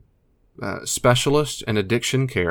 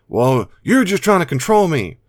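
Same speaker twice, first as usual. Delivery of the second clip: high, loud accusation; resentful addict; 8 semitones higher, 6 dB louder than usual. Pretend. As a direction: snap back like a cornered addict. Addict's retort in role-play.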